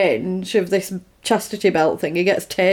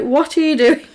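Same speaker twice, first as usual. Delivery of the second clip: higher-pitched mock bewilderment; retold appalled question, pitched up; baffled protest; 6 semitones higher than usual. Man to scientist who starts dancing, mock-baffled.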